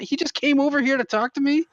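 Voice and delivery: very high incredulous question; baffled disbelief. Retold or imitated. Mother baffled by chatty stranger, high incredulous voice.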